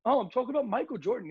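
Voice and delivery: mock flustered explaining; surprised clarification. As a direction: blurt it out, caught off guard. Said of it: "And I said".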